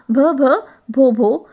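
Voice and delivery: repeated भ, भ, भो, भो with stuck starts; हकलाते हुए, घबराकर; stammering. Acted. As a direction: stammer nervously, stuck on the first sound. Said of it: "इस तरह से वो स्टैमर कर रही थी".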